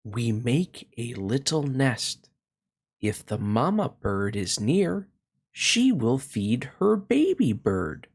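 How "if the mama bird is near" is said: ordinary delivery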